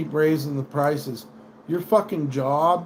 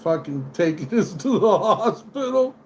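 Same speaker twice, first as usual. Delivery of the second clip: laughing retort; 5 semitones higher than usual. Retold or imitated. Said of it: "I'm like".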